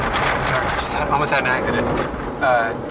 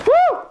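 sudden high yelp; startled high woo; 8 dB louder than usual. Real, not acted. Startled yelp as pigeons panic in the apartment. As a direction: yelp as a bird flaps past.

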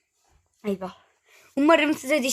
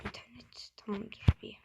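whispered aside; 3 semitones lower, 12 dB quieter than usual. Real, not acted. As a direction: mutter it off-mic to yourself. Aside while checking connection. (fısıltıyla kendi kendine)

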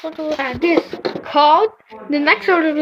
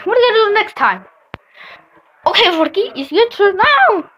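ordinary talk, nothing marked

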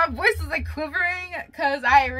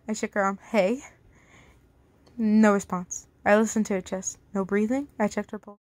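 narrated whisper; 3 semitones lower than usual. A narrator's reading. Reads a story aloud.

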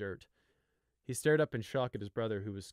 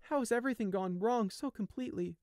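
despairing thought; 13 semitones higher than usual. Acted. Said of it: "he thought".

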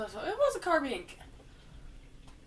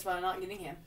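normal talking voice

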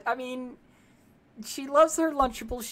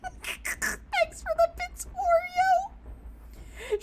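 much higher, slow words squeezed out through laughter; laughing thanks; much slower than usual. Real, not acted. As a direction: thank the viewer while cracking up. Laughing while thanking a viewer.

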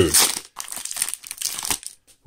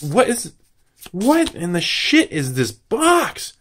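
ordinary talk

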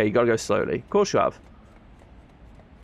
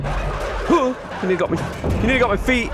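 being chased; 9 semitones higher than usual. Real, not acted. Dog nearly caught him.

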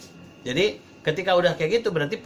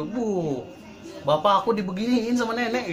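lightly acted child voice, higher; tattling grandchild; 6 semitones higher than usual. Grandchild telling mum about grandma scolding dad, tattling. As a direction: imitate a child reporting to mum. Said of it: "Kalau cucunya ngelihat gitu".

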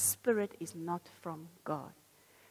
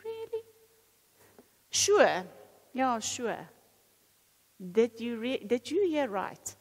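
doubting inner voice; 2 semitones higher, 5 dB louder, slower than usual. Acted. Preacher voicing the doubting voice to the congregation, mocking. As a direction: needle them like a sly tempter. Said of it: "You know that voice that says to you".

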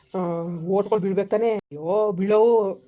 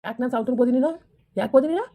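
raised, sharp demand at his wife; angry scolding; 4 semitones higher than usual. Acted. Husband confronting wife Kali, angry raised voice. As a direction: call her out and confront her hard.

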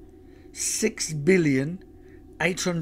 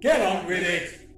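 impatient alien commander, raised voice; higher-pitched villain's sharp order; bellowed order; 5 semitones higher than usual. A narrator's reading. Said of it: "Sorb bellowed".

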